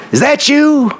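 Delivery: very high-pitched calling out; imitated yell. Friend calling to a honking car from her stoop, mock yell. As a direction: mimic a friend hollering a question from the stoop. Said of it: "And she'd yell it at me".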